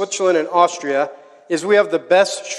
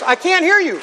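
high, playful call for more volume; rousing the audience; 10 semitones higher than usual. Real, not acted. Speaker to audience chanting along, rousing. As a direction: goad the crowd to chant along.

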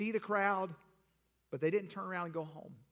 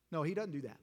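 usual speaking voice

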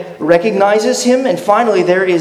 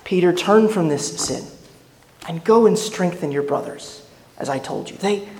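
tender forgiveness; 4 dB quieter than usual. Pretend. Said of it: "Could that look have communicated".

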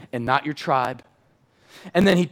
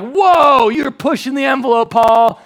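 loud, high mock alarm; mocking shocked crowd; 10 semitones higher, 11 dB louder, slower than usual. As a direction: shout it like scandalized churchgoers. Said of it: "everyone's like".

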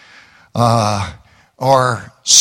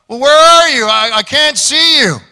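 calling out; 9 semitones higher, 4 dB louder than usual. Acted. Husband calling into dark.

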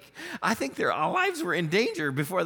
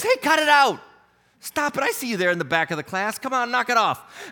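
stern teacher; 4 semitones higher, 5 dB louder than usual. Acted. Jesus scolds wind like pupils.

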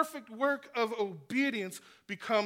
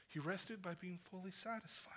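whisper; 3 semitones lower, 16 dB quieter than usual. Acted. Sermon drops to a whisper.

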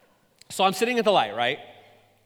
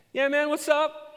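awkward friendly reply; 12 semitones higher, 3 dB louder than usual. Retold or imitated. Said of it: "I go".

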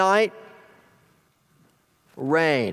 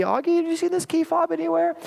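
put-on high, sweet voice for a little child; sweet coaxing parent; 11 semitones higher than usual. Parent asks wandering little son about the key fob, mock-sweet high coaxing. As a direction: coax it out in a much higher mock-sweet voice. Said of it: "asking our sweet little wandering Augie".

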